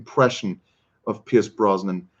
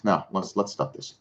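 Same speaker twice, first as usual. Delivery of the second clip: normal talking voice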